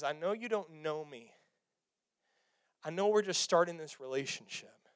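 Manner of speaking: soft, low and hushed, like God drawing Abraham close; gentle God voice. Acted. God gently introducing himself to Abraham, soft and low. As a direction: speak it softly and tenderly, as a patient father.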